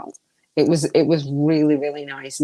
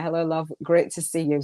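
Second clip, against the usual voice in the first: ordinary delivery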